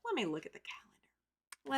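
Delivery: whispered aside to herself while checking. Real, not acted. Host reads her calendar aside in a whisper. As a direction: breathe it out, voiceless and private.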